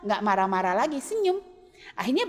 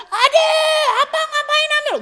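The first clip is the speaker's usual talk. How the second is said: high, loud mock scold of a little sibling; high, loud hammed-up sibling shout; 12 semitones higher, 7 dB louder than usual. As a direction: yell at the kid who took the eraser. Older sibling scolding younger.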